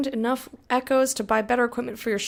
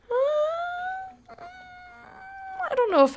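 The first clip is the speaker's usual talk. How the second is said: much higher, slow put-on squeak; silly squeaky voice; much slower than usual. Streamer doubting a chat opinion, squeaky voice. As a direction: disagree in a tiny cartoon voice.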